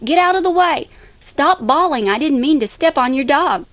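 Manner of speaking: high-pitched holler of alarm, easing off toward the end. A narrator's reading. People bumping into each other as fireworks fly, hollering. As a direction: holler it out like someone in a panicked crowd.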